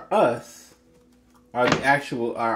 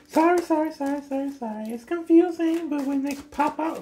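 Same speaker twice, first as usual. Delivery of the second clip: much higher, sing-song sorry; flustered apology. Real, not acted. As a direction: chirp the apologies up high. Apologizing as cards pop out.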